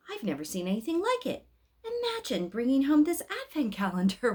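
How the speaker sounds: Mama's voice rising high and loud in wonder; marvelling Mama. A narrator's reading. Mama marvelling at the magic calendar, high and loud. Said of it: "Mama kept saying".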